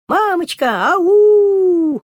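high, loud call through the bushes. Acted. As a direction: shout for mom across the forest. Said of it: "кричат".

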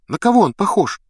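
high-pitched, pressing question; insistent. A narrator's reading. Hermione questioning Harry about the caster, insistent. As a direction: press him eagerly for an answer. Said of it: "настаивала Гермиона".

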